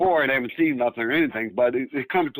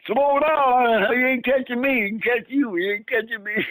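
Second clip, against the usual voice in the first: high-pitched calling out, only mildly raised volume; mock yelling; 8 semitones higher than usual. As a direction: retell someone yelling after a runner. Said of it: "He's yelling at me".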